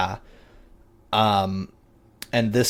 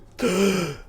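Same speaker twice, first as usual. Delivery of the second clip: sharp gasp; 10 semitones higher than usual. Acted. Creature takes first breath.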